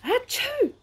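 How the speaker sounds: sneeze. A narrator's reading. Big sneeze in story.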